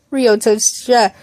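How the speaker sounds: ordinary delivery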